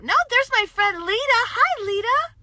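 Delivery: high, loud happy hello to a friend; excited greeting. Real, not acted. Greeting her friend Lita live on camera, loud and high. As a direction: call out to a friend in delight.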